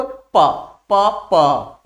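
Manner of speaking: "प, प, प," each separate, both lips meeting; demonstrated lip sound. Shown as a demonstration. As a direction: sound out "प, प, प," to show the lips closing. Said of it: "बोल के देखो". ("प, प, प," दोनों ओठ सटाकर, अलग-अलग)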